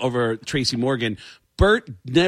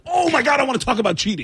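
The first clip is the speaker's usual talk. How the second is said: excited; 9 semitones higher, faster than usual. Retold or imitated. Excited quote of himself.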